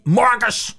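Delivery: sharp high call of the name, mock yell. An angry coach summoning his player, retold calling out. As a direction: bark the name like a mad coach.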